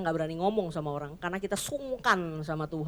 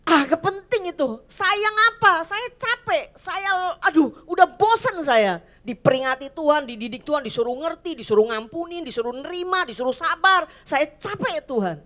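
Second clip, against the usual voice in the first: much higher, fed-up whining, slowing at the end; weary exasperated complaint; 7 semitones higher, 4 dB louder than usual. Retold or imitated. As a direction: vent tired frustration at God. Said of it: "hal ini terasa kayak". (keluhan capek dan jengkel, nada tinggi)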